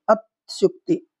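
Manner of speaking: slow अत्युक्ति, broken after the first syllable; slow word demonstration. Shown as a demonstration. Teacher showing the correct form, slow and broken up. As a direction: draw out अत्युक्ति with a break after "at". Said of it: "इस तरीके से होना चाहिए".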